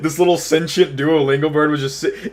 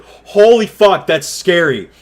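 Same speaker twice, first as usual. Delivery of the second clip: higher, louder retold fright; alarmed realization; 5 semitones higher, 5 dB louder, slower than usual. Telling viewers about nearly falling into the abyss, alarmed. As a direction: blurt it out as sudden dread. Retells his moment of fear.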